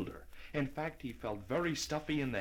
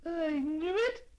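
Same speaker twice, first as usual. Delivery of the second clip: put-on high, slow, gloating old lady; triumphantly; 4 dB louder, slower than usual. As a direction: crow it in triumph. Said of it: "said the old lady triumphantly".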